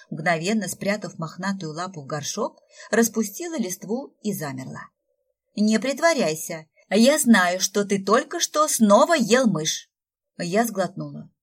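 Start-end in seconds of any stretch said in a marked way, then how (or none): 5.5-9.9 angry scolding. Acted. Raya keeps scolding the tree.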